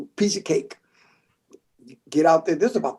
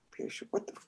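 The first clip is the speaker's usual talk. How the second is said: stunned whisper; 21 dB quieter, faster than usual. Real, not acted. Stunned reaction to call.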